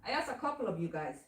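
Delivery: ordinary talk, nothing marked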